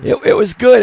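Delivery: ordinary talk, nothing marked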